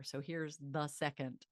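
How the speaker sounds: ordinary delivery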